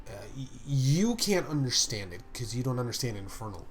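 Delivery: ordinary talk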